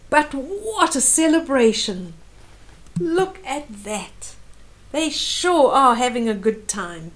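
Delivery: usual speaking voice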